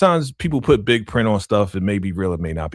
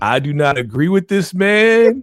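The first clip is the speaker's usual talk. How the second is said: mock respectful address; 6 semitones higher, 5 dB louder than usual. Cohost speaking to [name] over here, mock formal.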